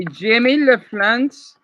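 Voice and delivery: slow, careful French phrase; slow teaching French; French demo phrase. Shown as a demonstration. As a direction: sound out Jimmy LaFrance slowly as a French lesson. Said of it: "if you wanna say".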